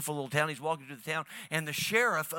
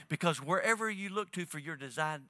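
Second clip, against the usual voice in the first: ordinary talk, nothing marked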